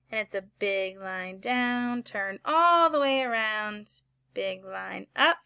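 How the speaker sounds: slow teacher guiding stroke; slow demonstration. Shown as a demonstration. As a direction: draw it out slowly. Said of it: "let's do it again".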